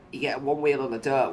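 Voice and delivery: ordinary delivery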